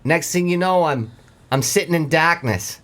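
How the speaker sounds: lightly voiced Mainer lobsterman telling his tale; Maine accent. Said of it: "from a Mainer perspective".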